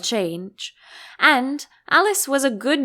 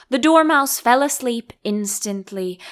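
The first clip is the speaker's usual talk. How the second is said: plain reading voice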